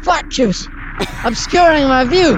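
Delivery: high, frantic calling out; panicked. Acted. Panic in the smoke.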